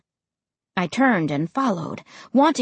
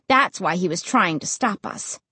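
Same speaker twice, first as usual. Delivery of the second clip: usual speaking voice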